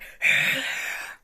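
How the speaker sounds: loud unvoiced growl into a microphone. Shown as a demonstration. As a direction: growl hard into the mic. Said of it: "you'd be like".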